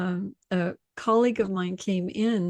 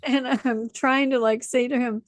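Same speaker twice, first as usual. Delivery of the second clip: usual speaking voice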